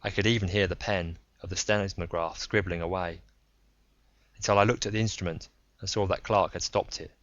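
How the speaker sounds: plain reading voice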